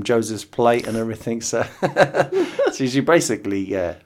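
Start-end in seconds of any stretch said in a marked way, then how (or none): none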